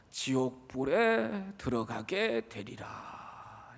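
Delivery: slow, soft, heavy scripture warning; solemn warning. Acted. Preacher warning the congregation of hellfire, slow and solemn. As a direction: let the doom sink in slowly.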